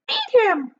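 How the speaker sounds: put-on high, loud, vain old aunt; shrill-voiced boastful aunt; Aunt Sponge's voice. A narrator's reading. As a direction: preen like a conceited hag. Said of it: "said Aunt Sponge".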